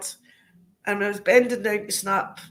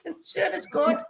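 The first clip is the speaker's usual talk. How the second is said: ordinary delivery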